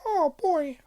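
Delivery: a half-hearted try at a high cartoon mouse; failed Mickey Mouse attempt. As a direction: pitch up for a squeaky catchphrase, then give up. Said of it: "I can't do it".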